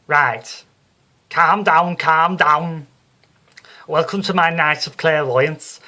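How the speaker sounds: loud, high Liverpool showwoman hushing the hall; Psychic Sue on stage. Acted. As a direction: work the crowd like a brassy Scouse medium. Sue opens her show to the crowd.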